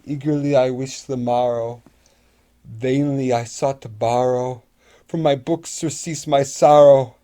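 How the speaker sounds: ordinary delivery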